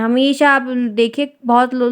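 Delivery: normal talking voice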